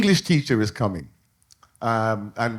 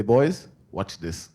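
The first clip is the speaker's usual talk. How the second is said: low, slow conspiratorial dad; sly dad; 6 semitones lower, slower than usual. Retold or imitated. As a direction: let the boys in on a trick, slowly. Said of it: "Dad would say".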